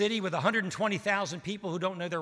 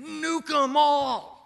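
much higher, louder, drawn-out mock cry; hammed-up vengeful prophet yelling for destruction; Jonah demanding destruction; 12 semitones higher, 6 dB louder, much slower than usual. Preacher voicing Jonah wanting the city destroyed, hammed-up.

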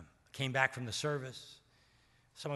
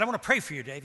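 Steve's earnest offer; 2 semitones higher, 8 dB louder, much faster than usual. Retold or imitated. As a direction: press the offer warmly.

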